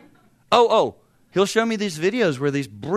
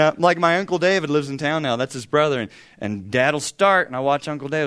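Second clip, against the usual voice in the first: ordinary talk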